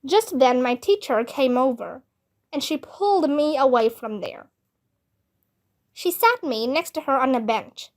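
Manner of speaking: ordinary delivery, nothing marked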